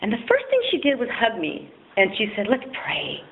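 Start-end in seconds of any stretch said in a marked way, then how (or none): 2.5-3.2 soft, breathy whisper; hushed. Retold or imitated. Church lady hugging her, whispering an invitation to pray. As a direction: hush it like starting a prayer.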